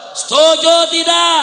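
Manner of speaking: slow, high, very loud crowd call; drawn-out pulpit roar; full rallying shout. Real, not acted. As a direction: bellow for the crowd's agreement.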